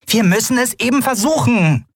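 high-pitched insisting voice; insist, masking despair, pitched high; Nelen, hidden desperation. A narrator's reading. Nelen ignoring Keru's doubt, high desperate insistence. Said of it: "die Verzweiflung aus ihren Worten".

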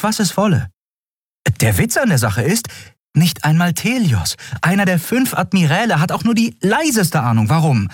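a hint of a stubborn young woman; lightly voiced Endriel. A narrator's reading. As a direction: make them believe you. Endriel explains herself at length.

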